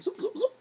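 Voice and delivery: high, light magic zaps; playful high magic sound effect; high zoop effect. Pretend. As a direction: squeak out the wand's zaps.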